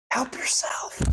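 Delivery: "Help yourself" breathed as a whisper. Shown as a demonstration. Preacher repeating God's offer to the congregation, whispered. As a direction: drop to a hushed, unvoiced whisper. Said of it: "repeated offer, whispered".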